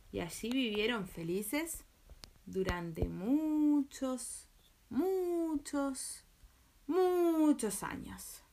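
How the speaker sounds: very slow, stretched repetitions; drawn-out ending. A narrator's reading. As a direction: linger on each muchos, rising. Drawn-out fairy tale ending.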